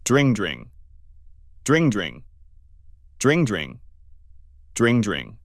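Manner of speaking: imitating an old phone ring, falling on each dring; a mock phone ring, repeated evenly. Narrator imitating the phone ringing at the door, mock ring.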